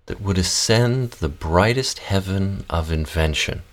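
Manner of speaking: measured theatrical verse reading with long pauses; reading Shakespeare verse. Shown as a demonstration. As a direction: recite the prologue with care between breaths. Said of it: "do your text as you like".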